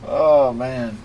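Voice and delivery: sudden high startled cry; jolted by a thunderclap. Real, not acted. Lightning strike right outside.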